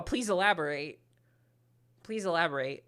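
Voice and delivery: ordinary talk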